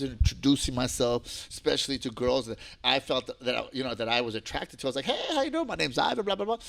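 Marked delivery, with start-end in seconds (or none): 5.1-6.6 high-pitched, breezy self-introduction; friendly greeting. Retold or imitated. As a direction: act out an easy hello to girls. Retelling his greeting to girls.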